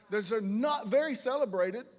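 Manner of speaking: ordinary talk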